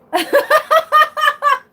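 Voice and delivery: loud high cackle; high shrieking laugh. Real, not acted. Cackling at a viewer in the chat.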